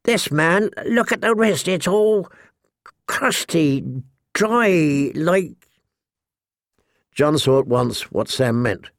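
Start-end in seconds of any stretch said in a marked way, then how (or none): 0.0-5.7 put-on high, halting, nervous boy. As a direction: stammer it out nervously. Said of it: "Sam's nervous, high-pitched voice".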